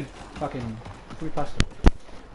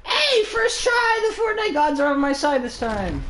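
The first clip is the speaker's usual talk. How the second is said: very high, loud, stretched cheer easing down; elated celebration; 12 semitones higher, 4 dB louder than usual. Real, not acted. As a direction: squeal in triumph. Celebrating success in the game.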